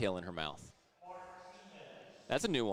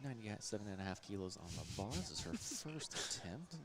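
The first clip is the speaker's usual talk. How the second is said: softer hushed golf announcer; golf commentator; 2 semitones lower, 11 dB quieter than usual. Pretend. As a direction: play along, quiet golf broadcaster. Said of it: "do the golf commentator's voice".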